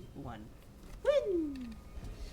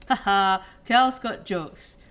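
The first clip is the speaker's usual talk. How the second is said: slow, louder mock laugh at the prank; sarcastic; 8 dB louder, slower than usual. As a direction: drag it out, unimpressed. Mocking the prank requisition.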